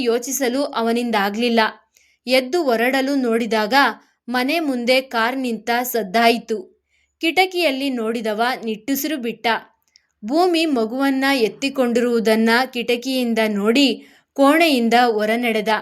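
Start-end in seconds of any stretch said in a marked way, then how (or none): none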